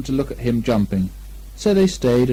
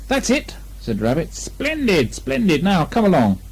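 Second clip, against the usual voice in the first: lightly voiced bear and rabbit; character voices; 6 semitones higher than usual. A narrator's reading. Pooh and Rabbit plot, lightly voiced. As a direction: swap between gentle bear and eager rabbit.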